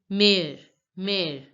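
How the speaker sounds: "Mère" with an open è, like "mair"; "Mère" said as a separate demo word; French pronunciation. Shown as a demonstration. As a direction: model "Mère" as mair, said twice. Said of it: "how we pronounce this word".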